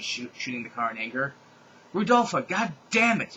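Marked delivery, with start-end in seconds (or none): 1.9-3.4 high, loud frustrated curse; frustrated yell. A narrator's reading. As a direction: yell it while kicking the tire. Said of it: "yelled Donna Jo".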